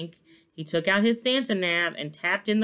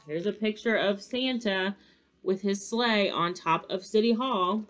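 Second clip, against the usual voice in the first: ordinary delivery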